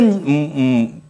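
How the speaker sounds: ordinary talk, nothing marked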